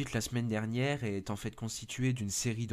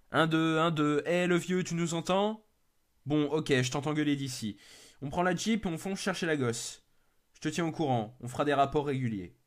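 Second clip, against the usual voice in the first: a lightly voiced rescuer, higher, on the radio; hint of a brash young rescuer calling the old man; radio rescuer Merlin; 5 semitones higher, 4 dB louder than usual. Merlin radios the old man at base, lightly voiced and breezy.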